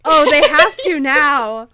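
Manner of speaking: slow, loud, swooning; swooning gush. Acted. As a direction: gush it out like a lovesick fan. Gushing over a hoped-for kiss.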